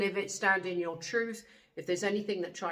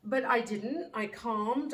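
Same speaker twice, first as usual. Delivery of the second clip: ordinary talk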